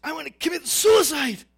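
retold desperate shout; retold distraught cry. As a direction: cry it out as she did, without tears. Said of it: "She was crying. She said".

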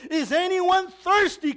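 high, slow, ringing shout; loud voice. Retold or imitated. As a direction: shout the question out to everyone. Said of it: "He said in a loud voice".

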